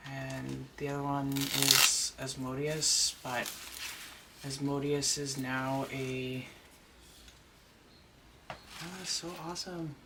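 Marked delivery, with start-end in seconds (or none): none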